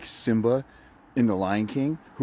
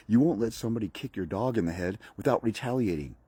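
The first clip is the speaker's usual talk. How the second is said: usual speaking voice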